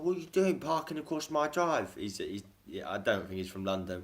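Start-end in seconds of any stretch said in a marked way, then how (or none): 0.0-1.9 high, indignant Cockney neighbour; high-pitched Cockney complaint; mock Cockney. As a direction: imitate an irate neighbour's put-on Cockney. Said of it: "he puts on a Cockney accent".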